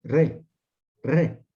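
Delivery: re said twice, short and clear; syllable demo. Shown as a demonstration. Teacher showing the transliteration, said distinctly. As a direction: model the sound re for students. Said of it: "Por eso es que traduzco".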